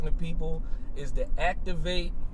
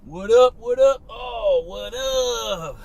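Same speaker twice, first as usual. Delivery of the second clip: higher, louder hype greeting; hyped greeting; 7 semitones higher, 5 dB louder, much slower than usual. Real, not acted. Host greeting his listeners, hyped and loud. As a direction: greet the crowd all fired up.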